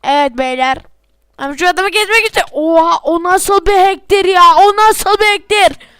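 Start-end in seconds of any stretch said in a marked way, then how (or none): 1.4-5.9 higher and louder in disbelief; indignant at the cheater; outraged disbelief. Real, not acted. Gamer outraged at a hacker, loud disbelieving.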